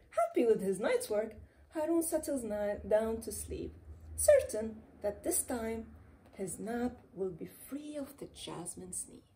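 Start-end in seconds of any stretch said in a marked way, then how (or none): none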